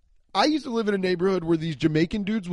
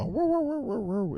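far-off dog woo; 8 semitones higher than usual. Retold or imitated. Comic imitating a pleasant far-off suburban dog barking. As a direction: croon a faraway dog's bark up high. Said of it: "a far-off dog bark".

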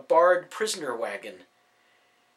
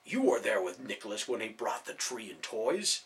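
softer, confiding question; lowered voice; 3 semitones lower, 5 dB quieter than usual. A narrator's reading. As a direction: keep it from being overheard. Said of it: "lowered his voice to avoid being overheard".

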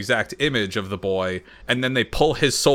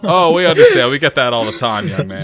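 condescending official; 4 semitones higher, 9 dB louder than usual. Retold or imitated. Voicing dog catchers condescendingly.

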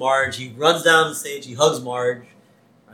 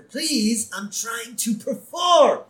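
higher, drawn-out mock pleading; exasperated plea; 8 semitones higher, much slower than usual. Rocker begging the band to let him perform, exasperated. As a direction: plead with them, stretching the words.

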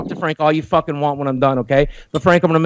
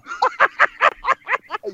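a sudden, much higher cackling laugh; high cackle; 4 dB quieter than usual. Real, not acted. Unhinged caller mocks threats.

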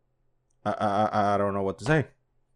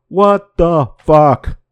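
loud disbelief; 4 semitones higher, 8 dB louder, much slower than usual. Real, not acted. Reacting to the UFO headline.

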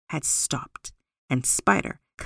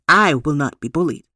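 firm Eagle; 3 semitones lower, 7 dB louder than usual. A narrator's reading. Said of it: "Eagle said".

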